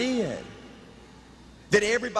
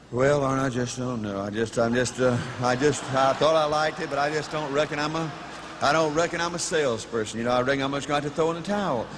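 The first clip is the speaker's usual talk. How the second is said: much lower, a beaten man giving up; defeated coach; 10 semitones lower than usual. Retold or imitated. Coach on the phone after a client said no, deflated and low. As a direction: play the quitter who has lost all heart. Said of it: "it sounded like a different person".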